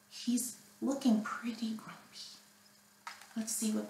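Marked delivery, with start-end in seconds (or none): 0.1-2.4 whisper. Acted. Reader whispers to children about grumpy Jim. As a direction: let the kids in on a secret.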